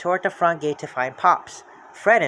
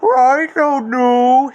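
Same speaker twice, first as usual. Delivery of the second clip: loud, slow dog voice; panting dog answer; Scooby voice; 8 semitones higher, 8 dB louder, much slower than usual. Acted. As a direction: drag it out like a cartoon dog. Scooby running from the creeper.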